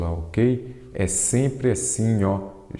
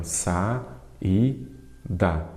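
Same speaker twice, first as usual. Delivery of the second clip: "Sa, i, da" with clear gaps between syllables; 2 semitones lower, much slower than usual. Shown as a demonstration. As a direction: split saída into three separate beats, Sa, i, da. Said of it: "Sempre dou esses exemplos também". ("Sa, i, da" dito sílaba por sílaba, com pausas)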